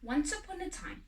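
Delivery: ordinary delivery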